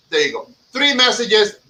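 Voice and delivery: usual speaking voice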